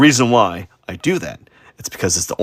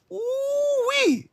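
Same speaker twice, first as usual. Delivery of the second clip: excited whoop; 6 dB quieter than usual. Real, not acted. Praising own good art.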